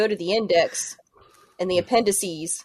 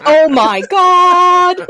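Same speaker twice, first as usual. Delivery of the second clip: very slow, much louder, higher oh my God; hammed-up slow-motion horror at the unmasking; stunned gasp; 11 semitones higher, 11 dB louder, much slower than usual. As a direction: drag out the shock like a slow-motion reveal. Everyone shocked at unmasking.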